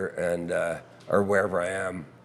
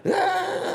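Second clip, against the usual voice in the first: very high held "ah" note; high sung wail. Shown as a demonstration. Songwriter shows where the song goes up, high wail. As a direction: leap up into a high held cry. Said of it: "I'll go up to the".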